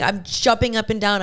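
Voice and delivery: ordinary delivery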